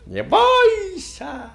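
very high, drawn-out coachman's call; thin singsong voice. A narrator's reading. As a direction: sing it out high and loud to the horses. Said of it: "примолвил тонким певучим голоском". (тонкий певучий голосок, высоко и протяжно)

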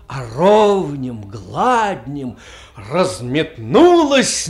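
dramatic recital, swelling loud and dropping to hushed phrases; impassioned literary recitation. A narrator's reading. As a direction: recite with sweeping, changeable intensity. (вдохновенная художественная декламация с перепадами от громкого к тихому)

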